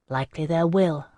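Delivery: ordinary delivery, nothing marked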